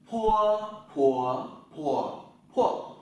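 "pua" with a strong puff of air, lips popping open, loud; aspirated pua drill. Shown as a demonstration. Teacher showing students how to aspirate "pua". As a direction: push the air out on "pua" through four tones. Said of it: "chữ P bật nhé".

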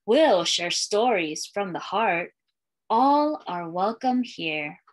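put-on lilting read-aloud for young kids, refrain given room; storytime reading. Librarian reading a welcome book to schoolchildren, storytime lilt. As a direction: read it like a picture book to a class.

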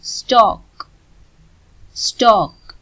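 स्टॉक said with no R before the final k; silent R. Shown as a demonstration. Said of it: "आर silent".

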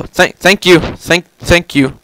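ordinary talk, nothing marked

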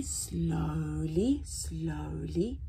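ordinary delivery

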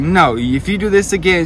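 mock her agitated, high warning; freaking out. Imitating her still warning him about the lost van, freaking out. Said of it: "she's still freaking out".

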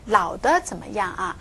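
usual speaking voice

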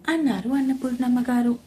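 plain reading voice

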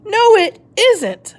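crossly. Acted. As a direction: insist it with rising annoyance. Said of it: "even more crossly".

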